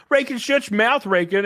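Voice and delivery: high-pitched mock scolding; hammed-up exasperation; yelling at chat. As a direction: scold the chatter in a high strained voice. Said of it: "I just talk to my chat like this".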